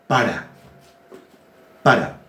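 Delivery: "Para" with the stress on the second A; stress on final A. Shown as a demonstration. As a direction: say "Para" as pa-RÁ, accent on the last syllable. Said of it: "Tilde en la segunda A". ("Para" con acento en la segunda A)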